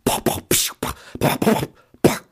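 whispered pops of fireworks; firework sound effect. Acted. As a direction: mouth fireworks, crisp pops. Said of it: "환영의 불꽃이 한번 또 터졌네요".

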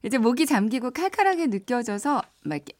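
ordinary talk

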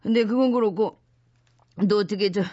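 low, nauseous groaning; queasy, sick voice. Acted. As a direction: sound sick and dizzy. Sick and dizzy from pills.

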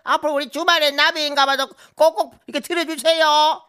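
put-on very high, loud old-granny character voice; 할머니 impression. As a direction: squeal thanks like a delighted comic granny. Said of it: "할머니 성대모사 할 때 엄청 재밌게 들었는데". (아주 높고 큰 할머니 성대모사)

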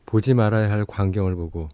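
ordinary talk, nothing marked

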